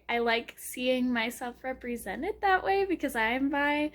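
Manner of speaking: ordinary talk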